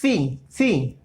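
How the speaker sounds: फी with the tongue between the teeth. Shown as a demonstration. Teacher shows the ci sound for students, lisped. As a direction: lisp फी like a Spanish th. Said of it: "दांतों के बीच में लाना है अपनी टंग को".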